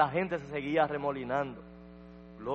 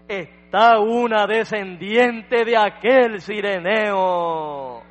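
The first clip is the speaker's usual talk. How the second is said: impassioned prayer building to a loud, drawn-out cry; preacher's fervent recited prayer, stretched and mounting; moving prayer; 2 semitones higher, 6 dB louder, slower than usual. Retold or imitated. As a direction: plead with the Lord slowly, swelling phrase by phrase. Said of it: "la conmovedora oración que hizo el profeta".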